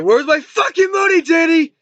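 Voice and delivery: high, loud acted outburst; mock angry demand. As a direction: imitate a dealer yelling for his money. Drug dealer demanding money at gunpoint.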